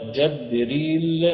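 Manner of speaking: جبريل slowly as "Jabril", a after the jim; Ibn Kathir's reading. Shown as a demonstration. Teacher attributing variants to readers, جبريل with fatha on the jim. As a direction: open the first vowel of جبريل to a. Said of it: "ده ابن كثير".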